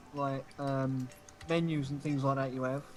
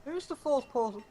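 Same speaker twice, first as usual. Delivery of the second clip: ordinary delivery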